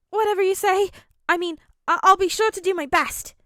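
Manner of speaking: high, eager and flustered; nervous promise; flustered boy voice. Acted. Midoriya embarrassed.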